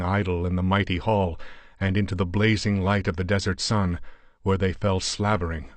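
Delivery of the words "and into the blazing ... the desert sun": usual speaking voice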